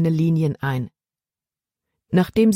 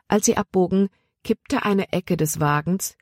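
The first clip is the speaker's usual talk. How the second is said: ordinary delivery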